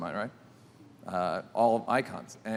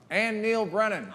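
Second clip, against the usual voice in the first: higher-pitched mock yell from the crowd; heckling yell at the toast; 10 semitones higher than usual. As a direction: call the name out as a teasing heckle. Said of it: "Chris Rock yells out".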